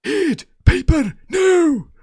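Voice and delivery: mock Scottish dad, high-pitched drawn-out yell; Mike Myers. Host doing a film dad on request, shouted Scottish accent. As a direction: bellow it slowly in a high, put-on Scottish accent. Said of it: "do your Scottish accent from".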